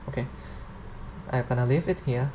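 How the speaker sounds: ordinary talk, nothing marked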